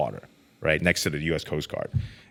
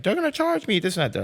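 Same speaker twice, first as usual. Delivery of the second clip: high, panicky mock outburst about the charge; panicking. New inmate panicking to cellmates about the charge, high and frantic. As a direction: play your own jailhouse panic back, half joking.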